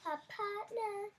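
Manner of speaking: slow, high sing-song; drawn-out playful repeat; teasing echo. Real, not acted. Echoing the word teasingly.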